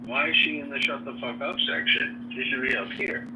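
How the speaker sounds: normal talking voice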